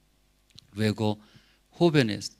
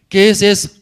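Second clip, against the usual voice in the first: high, loud startled question; surprised; 8 semitones higher, 12 dB louder than usual. Retold or imitated. Seeing the dessert arrive, loud and surprised. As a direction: gasp at the strange dish.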